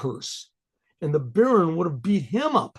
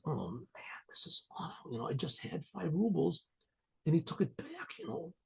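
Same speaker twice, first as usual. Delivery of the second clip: hushed, breathless whisper to himself; whispered thought; 12 dB quieter than usual. Retold or imitated. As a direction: whisper it as if afraid to be overheard. Panicked inner thought, whispered.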